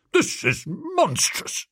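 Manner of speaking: high, drawn-out indignation without shouting; outraged old duke. A narrator's reading. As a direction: splutter slowly in high-pitched outrage. Old duke outraged at being doubted.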